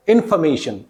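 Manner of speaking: इन्फॉर्मेशन as in-fuh-MAY-shun; weak FOR syllable. Shown as a demonstration. Teacher modelling correct word for students, इन्फॉर्मेशन with weak fuh. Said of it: "for की जगह फे हो जाएगा".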